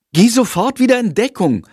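put-on boy sternly ordering his bat friend back into hiding; stern command. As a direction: tell him off and mean it. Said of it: "befiehlt Jendrik streng".

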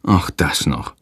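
low, weary groan; a tired groaning aside; groaning sigh. Acted. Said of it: "stöhnt Hans".

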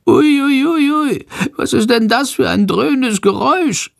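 high-pitched, nervous little creature voice; anxious. A narrator's reading. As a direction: squeak it out like a frightened little animal. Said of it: "fragt er ängstlich". (ängstlich, mit hoher Stimme)

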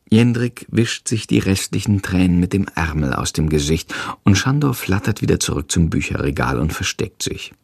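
ordinary delivery, nothing marked

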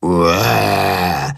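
low, drawn-out "uah"; imitated mock roar. A narrator's reading. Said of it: "wiederholt Willi".

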